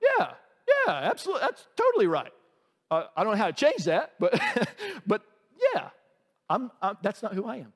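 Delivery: put-on high, fast sheepish kid voice; sheepish nephew. Nephews agreeing sheepishly, high, fast and sheepish. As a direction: brush it off like a caught teenager. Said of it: "And they're like".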